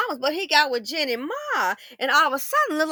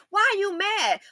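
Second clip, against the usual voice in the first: normal talking voice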